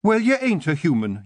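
a sharp Scots mother's retort; snapping Kelda. Acted. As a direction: cut her daughter short. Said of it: "Jeanie snapped".

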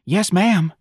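high, eager character reply; enthusiastic. Acted. Darlene answering her boss, enthusiastic. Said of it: "Darlene said enthusiastically".

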